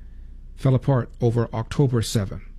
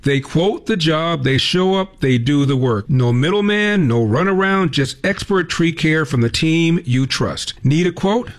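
upbeat, louder, higher pitched ad pitch; radio ad read; 5 semitones higher, 4 dB louder than usual. Acted. Host reading a sponsor ad to listeners, upbeat. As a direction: sell the local tree service like a spokesman.

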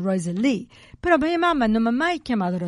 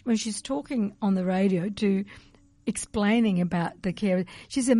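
ordinary talk

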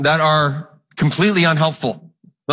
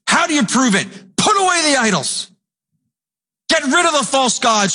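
normal talking voice

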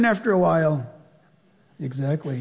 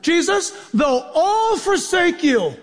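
boastful Peter; 8 semitones higher, slower than usual. Pretend.

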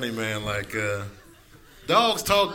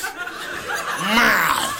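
yelling cat; 3 semitones higher than usual. Acted. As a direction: yowl like a hungry cat at dawn. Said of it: "cats are yelling at you".

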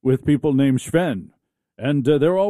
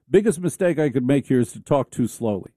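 ordinary talk, nothing marked

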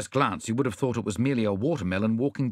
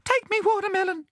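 very high, squeaky bandicoot plea; high-pitched creature begging for mercy; terrified gasp. A narrator's reading. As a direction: beg in a tiny terrified squeak. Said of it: "he gasped".

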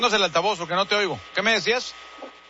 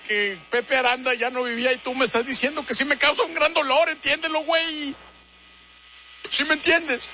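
pained father; 6 semitones higher than usual. Acted.

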